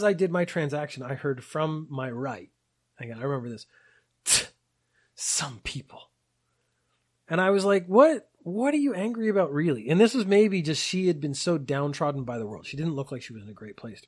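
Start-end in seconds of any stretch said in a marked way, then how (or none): 4.2-6.1 disgusted aside. Retold or imitated. Lady's disgusted aside, retold. 7.9-9.9 baffled protest. Retold or imitated. His baffled reaction, retold.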